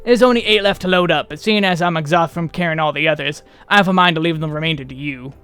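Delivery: lightly voiced rustic body-hauler; casual rustic grumble, falling away at phrase ends; folksy weary labourer. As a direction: talk like a plain-spoken country workman. Reads folksy labourer's dialogue in character.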